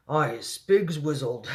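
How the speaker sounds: slow giant's mock yell; a touch of yelling, in character; mild yell. A narrator's reading. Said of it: "yelled the child chewer".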